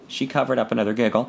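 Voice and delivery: plain reading voice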